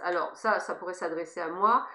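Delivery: ordinary talk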